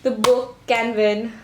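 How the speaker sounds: ordinary delivery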